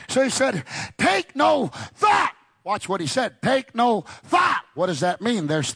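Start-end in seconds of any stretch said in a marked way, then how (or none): 1.0-2.3 high, slow shouted proclamation; shouted scripture. Acted. Preacher shouting the verse to the congregation, slow and loud. 3.4-4.6 high, slow shouted proclamation; shouted scripture. Acted. Preacher repeats the scripture.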